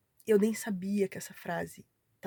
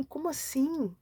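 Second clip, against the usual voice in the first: higher-pitched, baffled question; retold bewilderment; 6 semitones higher, slower than usual. Recalling her muddled head on reading the prophecy, bewildered. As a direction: act out total confusion. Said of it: "minha cabeça virou uma bagunça".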